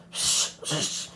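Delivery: unvoiced spitting hiss; spitting out coffee. A narrator's reading. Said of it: "he spat".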